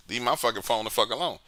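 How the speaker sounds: ordinary talk, nothing marked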